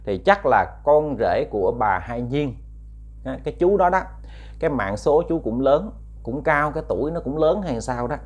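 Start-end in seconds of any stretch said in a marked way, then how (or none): none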